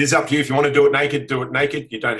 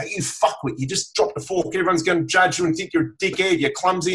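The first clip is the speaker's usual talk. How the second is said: higher-pitched mock self-scolding; dropping a fork at dinner, higher mock self-berating voice; harsh inner critic; 7 semitones higher than usual. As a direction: sneer at yourself over the dropped fork.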